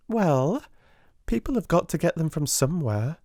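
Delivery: put-on high girlish schoolgirl voice; teasing Jessie. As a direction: play the gossipy younger girl.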